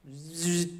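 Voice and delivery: soft imitated cicada buzz; quiet bug drone; a gentle zzz like a cicada; buzzing noise.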